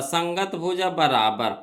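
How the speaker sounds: ordinary delivery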